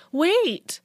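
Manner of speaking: high, loud alarmed cry; exclaiming. A narrator's reading. Narrator halts friends leaving for the lab, exclaiming. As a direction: call out sharply to stop them. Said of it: "I exclaimed".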